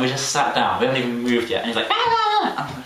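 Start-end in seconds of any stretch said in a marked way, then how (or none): 1.9-2.7 put-on kid screeching. Kid screaming on plane.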